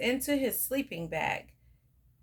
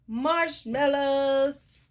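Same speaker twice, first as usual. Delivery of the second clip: drawn out; 4 semitones higher, 6 dB louder, much slower than usual. A narrator's reading. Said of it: "what's the main ingredient".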